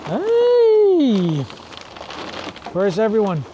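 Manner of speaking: loud high call across the house. Real, not acted. Calling out to find everyone.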